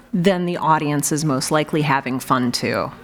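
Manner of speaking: usual speaking voice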